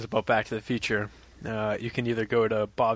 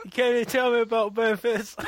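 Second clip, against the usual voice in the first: high put-on English accent; 11 semitones higher, 3 dB louder than usual. Host reads a listener's email in a mock English accent. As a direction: read it as a Manchester Englishman. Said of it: "read this in your English accent".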